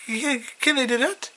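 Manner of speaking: high-pitched worried mom; pitched well up, lightly acted mother; Sheila. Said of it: "then Sheila, uh, as always, goes".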